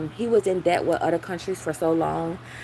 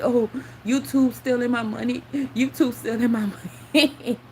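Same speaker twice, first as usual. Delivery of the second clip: whining higher, lightly mocking; lightly voiced crybaby complaint; mock whiny complainer; 5 semitones higher than usual. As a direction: mimic a whiny YouTuber crying about money. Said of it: "they go crying about it".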